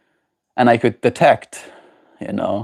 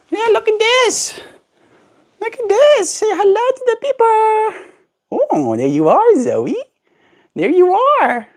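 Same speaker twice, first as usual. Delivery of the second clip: high cooing pet voice. Real, not acted. Owner showing the bird to viewers, high cooing.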